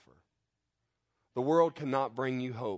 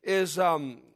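high, loud, full-force preaching; fiery preaching; 11 semitones higher, 4 dB louder than usual. Acted. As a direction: preach it out with fire. Fiery sermon climax, raised voice.

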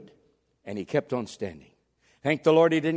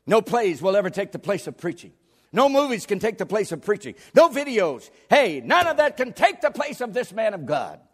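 emphatic list climbing higher; full-force preaching; 8 semitones higher, 7 dB louder than usual. Real, not acted. Preacher defending preaching to his congregation, rising fervor. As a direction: hammer each "no", building to a high proclamation.

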